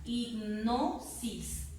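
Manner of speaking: "Hipnosis" very slowly, NO stretched out; syllable by syllable, "Hipnosis" peaking on NO; stressed syllable demo. Shown as a demonstration. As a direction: lean on NO in "Hipnosis". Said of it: "la mayor fuerza".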